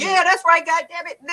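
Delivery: 得意げな友人を高い声で真似る; boastful. Retold or imitated. Friend's triumphant boast retold.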